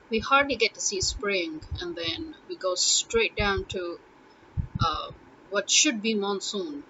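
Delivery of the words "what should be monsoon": usual speaking voice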